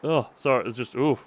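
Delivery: ordinary talk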